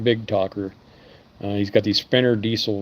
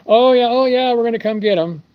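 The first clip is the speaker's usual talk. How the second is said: high, loud, airy brush-off; mock-cheerful stalling customer voice; breezy customer; 12 semitones higher, 5 dB louder than usual. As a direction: play the customer shrugging it off lightly.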